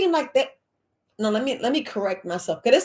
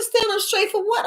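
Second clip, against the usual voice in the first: high, indignant "for what?"; incredulous protest; 11 semitones higher than usual. Retold or imitated. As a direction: shoot the question back in disbelief. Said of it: "I said".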